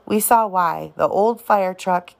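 ordinary delivery, nothing marked

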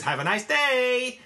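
drawn-out sugary have-a-nice-day; cheery American. Retold or imitated. Englishman mocking a cheerful American, slow and sing-song. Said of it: "the stereotype over here is".

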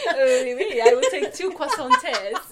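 laughing through a slow joke; laughing while speaking, slowly. Real, not acted. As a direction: giggle through the words.

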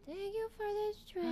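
put-on high little girl reciting. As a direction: imitate a child echoing her line. Child repeating prompted line.

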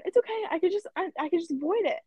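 high, appeasing retold reassurance; placating cashier. Cashier placating the angry customer.

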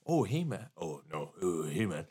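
low, soft dismissive greeting; mock indifferent mumbled hello; unenthusiastic celebrity. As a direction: brush off a fan, imitating him. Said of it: "I'm gonna make him sound shittier".

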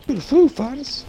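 squeaky cartoonish joke voice; goofy high voice. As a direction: pitch it way up for the gag. Repeats the pun goofily.

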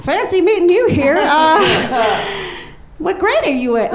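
put-on high, sing-song drunk greeting; drunk friendly chatter. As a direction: chirp brightly at the kid. Drunk chat with a child.

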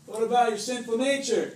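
high, loud taunt; mock accusing enemy. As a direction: call them out like a nagging accuser. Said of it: "the enemy is like".

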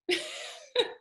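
a high giggle, half embarrassed. Real, not acted. Guest laughing at her karaoke pick, high giggle. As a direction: giggle at your own pick.

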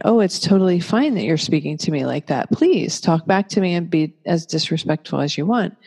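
ordinary talk

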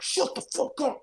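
mock the mother snapping at her son; imitating angry mom. Mom scolding him at night.

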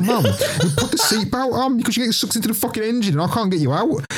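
put-on high, laughing kid's desperate plea; panicked child. Boy warning mum about the engine, high and laughing. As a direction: squeal it like a frightened little boy.

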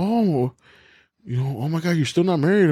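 nagging relative. Retold or imitated. Imitating an aunt fussing about marriage, mock dismay. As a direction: tut like a disappointed aunt. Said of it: "I was like".

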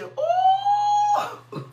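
ooh flung up high, then Jesus; a spirit-filled cry soaring up; high ecstatic ooh. Real, not acted. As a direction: falsetto praise cry. Preacher overcome mid-sermon.

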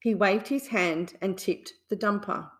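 ordinary delivery

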